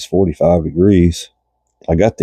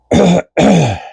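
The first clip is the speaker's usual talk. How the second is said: a hacking cough, congested chest; real chesty cough; 12 semitones higher than usual. Real, not acted. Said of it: "it's catching up to me now".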